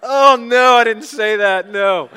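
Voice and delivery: loud, high, laughing take-back; cracking up and backpedalling; laughing denial. Real, not acted. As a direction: burst out in a laughing retraction. Mock denial after joke, congregation laughing.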